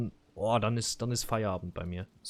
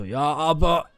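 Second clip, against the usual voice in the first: higher and louder insistence; retold outburst; 5 semitones higher, 6 dB louder than usual. Customer retelling insisting on his contract, loud and higher. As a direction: hammer home the contract point. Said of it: "Ich bin komplett ausgeflippt".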